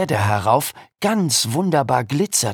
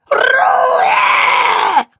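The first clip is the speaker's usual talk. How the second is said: very high, loud shrieking command; dragon shout; 9 dB louder than usual. Acted. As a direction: shriek it like an angry dragon teacher. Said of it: "schrie jetzt der Drache".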